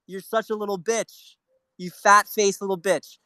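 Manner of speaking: high, loud contemptuous yell; furious insult. Real, not acted. Streamer insults a TV host on air, furious high yell. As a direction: spit the insult out in rage.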